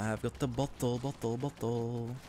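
muttering to self. Real, not acted.

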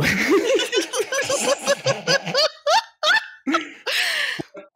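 a long, high burst of laughter; high laughing fit. Real, not acted. Cracking up at his own joke about the name Bo, high laughter.

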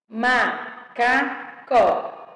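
very slow "Macacó", ma-ca-có in separate beats; syllable by syllable. Shown as a demonstration. Teacher separating words for pupils, syllable by syllable. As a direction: sound out each syllable of "Macacó". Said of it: "separação de palavras e sílabas".

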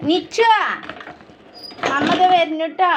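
mother calling out that she is coming, high and loud. Real, not acted. Mother calls to child.

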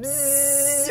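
a quick mock drill buzz, mouth noise. Mimicking construction noise in the grocery store, buzzing. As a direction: imitate a power drill with a buzzing hum.

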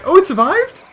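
startled question; surprised. Real, not acted. Surprised at survival.

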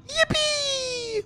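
high squealed yippee; 1 gleeful yippee. Real, not acted. Gamer cheering as he swings on the hook. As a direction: squeal it like a coaster ride.

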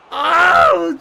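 sharp high shout of surprise; shocked shout. Real, not acted. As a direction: yelp as the ball goes in. Gol anında şaşkın bağırış. (yüksek, ani şaşkınlık bağırışı)

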